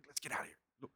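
hushed, barely audible whisper; sneaky whisper. Acted. David urges escape from the camp, furtive whisper. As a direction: whisper it so nobody wakes.

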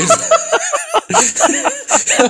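sudden high burst of laughter; laughing hard at his own joke; big laugh. Real, not acted. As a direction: break into a long cackle. Host cracks up at joke.

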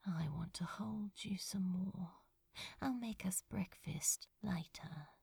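soft tender whisper. Acted. Crow whispering to sleeping Azra, tender whisper. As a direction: murmur it close, not to wake him.

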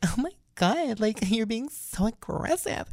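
high, slow, mock-offended voice with a laugh; offended ditzy woman. Narrator mimics the woman complaining he is aggressive, high and slow. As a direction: whine that he's too rough. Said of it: "she was just like".

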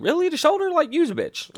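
can't believe they went for the shoulder; incredulous quote. Retold or imitated. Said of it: "I'm like".

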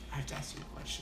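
whispered, secretive question; imitating coworker's whisper; hushed. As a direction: lean in and whisper. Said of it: "he comes over and goes".

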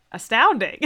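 ordinary delivery

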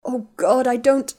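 high, loud words that collapse into sobs; tearful, broken cry; sobbing break. Acted. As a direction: fall apart mid-sentence. Ginny sobbing into her shoulder.

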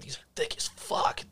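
hushed amazed whisper. Retold or imitated. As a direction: whisper it in awe. Whispered aside about thick burgers.

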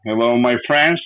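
ordinary delivery

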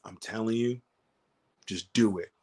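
low, hushed retold aside; confidential urging. Wrestler privately urging his doubtful opponent on, hushed. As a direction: quietly press him, keep it between you.

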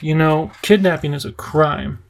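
plain reading voice

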